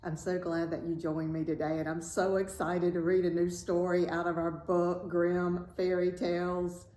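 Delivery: ordinary delivery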